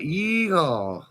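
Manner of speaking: high, whooping cowboy holler; cowboy yeehaw. Pretend. Oil field man asked about his accent, whooping yeehaw. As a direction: throw out a playful Texas yeehaw. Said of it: "They call it a West Texas drawl".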